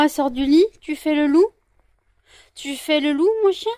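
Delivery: high, loud questioning pet voice. Real, not acted. Owner asking her dog if it howls, high baby talk. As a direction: tease the dog sweetly.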